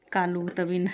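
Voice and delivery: usual speaking voice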